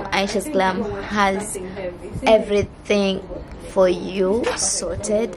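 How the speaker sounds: usual speaking voice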